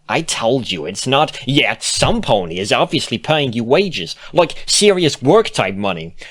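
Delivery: loud, high, indignant scolding; heated accusation. A narrator's reading. Bon Bon confronts Lyra about money.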